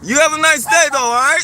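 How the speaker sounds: loud, curt goodbye; loud call-out. Real, not acted. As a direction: shout a dismissive farewell.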